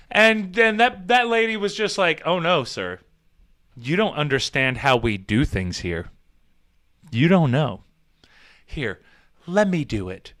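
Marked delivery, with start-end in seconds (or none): 2.3-10.3 low soothing nurse voice, lightly voiced; calm him down like a patient; gently reassuring nurse, understated; comforting nurse. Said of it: "said it all nice and, like, comforting".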